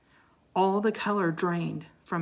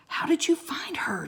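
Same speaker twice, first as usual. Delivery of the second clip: whisper; 8 semitones higher, 4 dB quieter than usual. Acted. Jack asking Teddy during the reunion.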